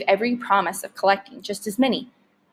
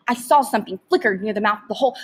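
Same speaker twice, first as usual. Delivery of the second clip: usual speaking voice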